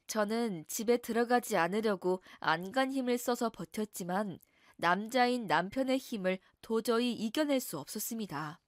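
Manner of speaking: ordinary delivery, nothing marked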